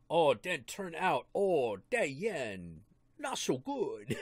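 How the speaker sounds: slow, hammed-up Chinese accent; mock Chinese accent. Friend jokes about the yen in a mock Chinese accent. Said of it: "stereotypical Chinese accent".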